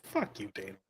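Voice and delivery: low under-the-breath fuck you; muttered aside to no one; muttered retort. Retold or imitated. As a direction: mutter it like a private jab. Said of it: "And I'm just like".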